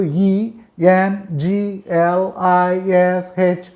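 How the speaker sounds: slow letter-by-letter spelling; spelled out slowly. Shown as a demonstration. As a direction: spell "ఈఎన్జిఎల్ఐఎస్హెచ్" one drawn-out letter at a time. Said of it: "రాసేదేమో ఈఎన్జిఎల్ఐఎస్హెచ్".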